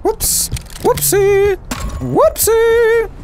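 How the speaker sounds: high, teasing whoopsie; mock whoopsie. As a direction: play it cute and mocking.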